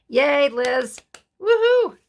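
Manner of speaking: high, loud whoop of praise; cheering. Real, not acted. Praising Liz's slow stitching, loud cheering whoop. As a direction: cheer the friend on.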